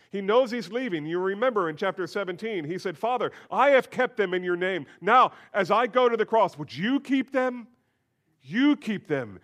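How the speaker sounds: loud, high pleading prayer; preacher voicing Jesus' prayer, pleading aloud; impassioned preaching. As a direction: cry out the prayer with mounting intensity.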